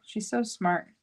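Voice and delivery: ordinary delivery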